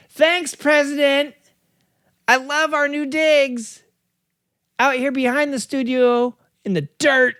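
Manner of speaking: high, slow cartoonish voice; silly character voice. Goofy sidekick thanking the president, high and slow. Comic character bit with dog.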